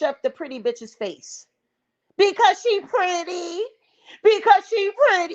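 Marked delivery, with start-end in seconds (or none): 2.2-5.3 high-pitched mock Florida girl. Host mimicking the jealous rival, mocking Florida girl voice. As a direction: parrot her in a high, jeering voice. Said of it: "You sound very much like a motherfucking Florida girl".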